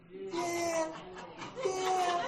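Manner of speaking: quiet, half-hearted mock yeah from bored fans; unimpressed crowd. Bored fans in the stands, mock lukewarm murmur. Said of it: "them people be sitting back there like".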